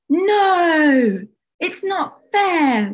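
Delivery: high, long drawn-out wail. Acted. Summer wails over the fallen sandcastle, high protest. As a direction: wail in protest, stretching the words. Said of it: "wailed Summer".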